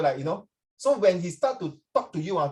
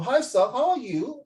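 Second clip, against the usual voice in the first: lightly imitated mainland Chinese accent with American slang, rising high; 6 semitones higher, slower than usual. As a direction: greet like a Chinese speaker with a slight American twang. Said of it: "then China people".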